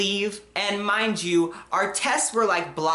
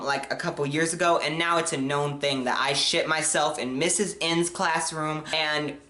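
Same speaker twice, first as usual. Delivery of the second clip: usual speaking voice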